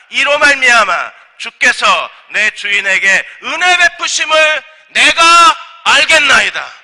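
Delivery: loud, rising declaration to God; fervent prayer. Acted. Servant praying for a sign from God, fervent prayer. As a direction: build to a high, slow, ringing close.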